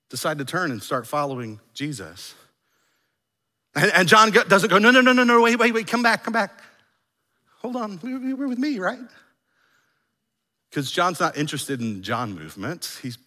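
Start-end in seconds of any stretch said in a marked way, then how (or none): 4.8-6.6 high, hurried pleading, hammed-up; mock panic. Imagined John to departing disciples, mock panicked pleading. 7.6-9.0 high, small, soft voice; mock wounded plea. Imagined John to departing disciples, mock meek pleading.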